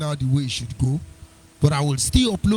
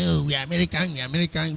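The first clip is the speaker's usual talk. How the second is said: mocking quote; faster than usual.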